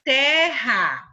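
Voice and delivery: drawn-out, divided "terra"; syllable by syllable. Shown as a demonstration. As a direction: say "terra" loudly in two stretched beats. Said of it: "Um R vai com a primeira sílaba".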